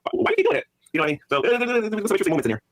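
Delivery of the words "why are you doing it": pitched-up incredulous question; mock disbelief at her zipping; baffled quote. Streamer retelling the coworker in the tent, incredulous high quote. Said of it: "I'm looking at her like".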